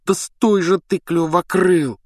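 high-pitched exasperated order, name drawn out; urgent command. Acted. Harry restraining the tugging hippogriff, urgent command. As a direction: strain to make the animal stay put. Holding back the struggling hippogriff.

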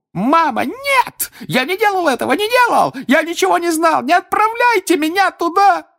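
very high, loud terrified screaming; shriek breaking into a squeal. A narrator's reading. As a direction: scream in panic, voice cracking. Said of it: "срываясь на визг, крикнул".

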